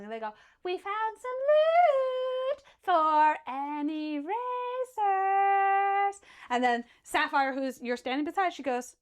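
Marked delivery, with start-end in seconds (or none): 0.6-6.2 higher, slow sing-song tease; teasing girl. Acted. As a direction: lure them in playfully. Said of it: "laughing and cackling and giggling".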